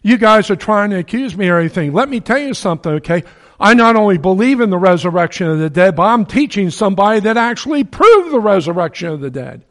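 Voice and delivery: high, loud, defiant address; Paul challenging the council, loud and high; bold declaration. Retold or imitated. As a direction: declare it to the accusers, pushing hard. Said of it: "and said".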